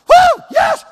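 very high, loud celebratory whoop; fired-up whoop. Retold or imitated. Preacher mimics young football players getting up, fired-up whoop. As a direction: imitate a hyped young player's high yell. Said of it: "they're all fired up".

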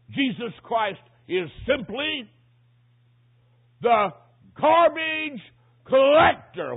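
high, loud, slow indictment; thundering pulpit accusation; full-force preaching. Real, not acted. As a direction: build word by word to a shout.

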